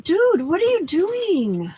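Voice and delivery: high, slow drawn-out question to the cat; pet voice. Real, not acted. Owner asking her cat what it's doing, high slow pet voice. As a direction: ask the cat, baffled and fond.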